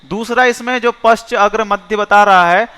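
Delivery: ordinary talk, nothing marked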